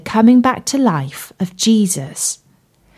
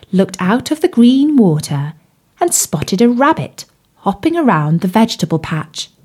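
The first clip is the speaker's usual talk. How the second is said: ordinary delivery, nothing marked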